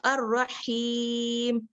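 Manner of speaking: अर्रहीम recited very slowly, lips not wide open; slow correct zer. Shown as a demonstration. Teacher demonstrates अर्रहीम slowly to students. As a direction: draw out अर्रहीम with a narrow mouth. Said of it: "पूरा मुंह नहीं खोला हमें".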